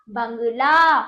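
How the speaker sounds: बंगला loud, with a long ला; emphatic model pronunciation of बंगला; open-mouthed nasal demo. Shown as a demonstration. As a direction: project बंगला with an open mouth, drawing out the last vowel. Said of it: "मुंह खोल के बोलोगे".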